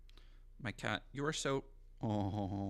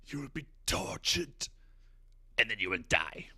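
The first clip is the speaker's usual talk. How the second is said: Emperor voice. Retold or imitated. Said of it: "where he goes".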